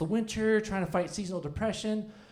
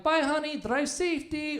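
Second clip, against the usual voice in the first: lightly imitated Brazilian accent, higher pitched; imitating wife's accent; 6 semitones higher than usual. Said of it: "her accents are awesome".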